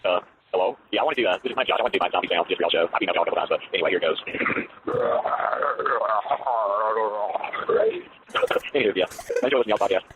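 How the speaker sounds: deep growly monster voice; low rumbling zombie growl; Cookie Monster voice. Retold or imitated. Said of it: "that sounded like the Cookie Monster".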